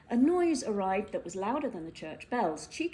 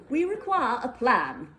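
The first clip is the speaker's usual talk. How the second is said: slow, louder, pompous proclamation; mayor pronounces to the crowd, pompously; pompous declaration; 5 semitones higher, 7 dB louder than usual. Acted. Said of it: "he continued pompously".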